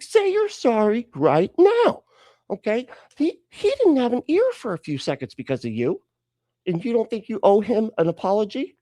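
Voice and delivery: high-pitched, exasperated telling-off; hammed-up stern parent, pitch climbing; scolding parent. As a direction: lay down the law to a child. Jesus scolds Peter like parent.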